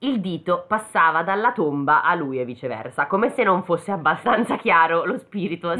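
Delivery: normal talking voice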